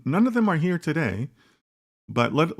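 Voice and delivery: ordinary talk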